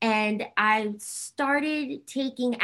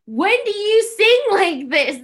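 high, loud, amazed friend voice; surprised friends; 10 semitones higher, 10 dB louder than usual. Retold or imitated. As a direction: play the stunned friend, slow and high. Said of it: "they were like".

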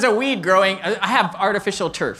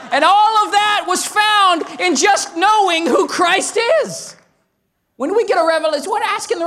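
excited shout; 10 semitones higher, 4 dB louder than usual. Real, not acted. Pastor carried away by Jesus, shouting. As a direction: let the joy burst out of you. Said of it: "I get a little excited".

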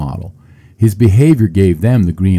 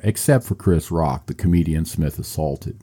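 normal talking voice

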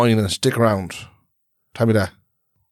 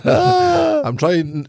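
giggling out the words, much higher; laughing; 13 semitones higher than usual. Real, not acted. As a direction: laugh through it, caught out. Laughing at being teased.

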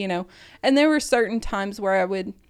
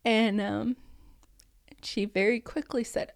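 normal talking voice

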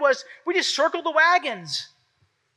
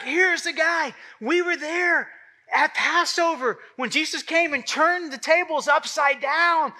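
higher, eager voice of a crowd thrilled at Jesus coming; excited crowd; 4 semitones higher than usual. Acted. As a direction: gush like fans awaiting the miracle worker. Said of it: "And everyone's so excited".

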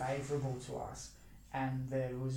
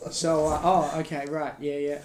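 awkward interviewer; 2 semitones higher, 11 dB louder than usual. Pretend. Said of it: "when we interview Hitler".

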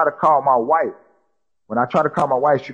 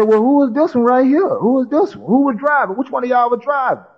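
higher-pitched imitated officer asking questions; voicing the cop; 10 semitones higher than usual. Officer questioning the crash victims, imitated higher. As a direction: press them on who was driving, pitched up.

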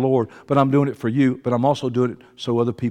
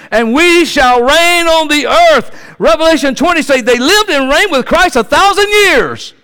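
scripture shouted louder and higher as it goes; full-force preaching; 8 semitones higher, 12 dB louder than usual. Real, not acted. Preacher proclaiming the song of the redeemed, full-force preaching. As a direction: stretch the last words into a high shout.

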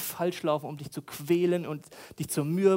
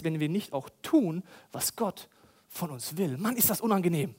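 ordinary delivery

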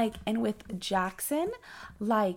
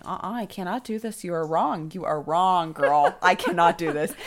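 louder, stubborn protest; wife arguing with the nurse, loudly; loud refusal; 7 dB louder than usual. Retold or imitated. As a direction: argue back in pain. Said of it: "I was like".

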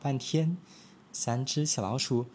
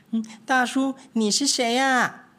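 higher, louder little mouse calling out; ringing call; 12 semitones higher, 6 dB louder than usual. Acted. Mice loudly asking the big cat who he is, ringing. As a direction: call it out boldly like a brave mouse. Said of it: "用洪亮的问声".

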